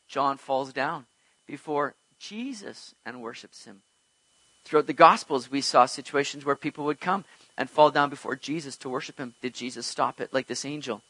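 usual speaking voice

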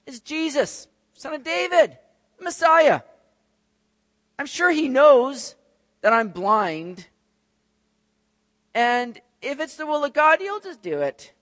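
high, drawn-out passive musing; mock trusting complacency; sitting back. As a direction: reason lazily in a put-on high voice. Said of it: "he could have sat back and said".

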